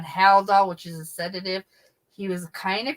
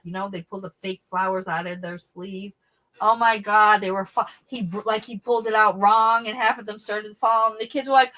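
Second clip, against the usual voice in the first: ordinary talk, nothing marked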